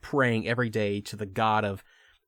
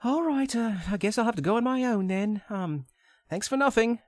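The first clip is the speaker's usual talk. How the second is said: higher put-on Lara voice; pretend Lara; 9 semitones higher than usual. Lara argues with Greg, higher put-on voice. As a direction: coax him in a high girlish voice.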